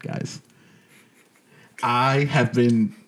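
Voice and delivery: ordinary talk, nothing marked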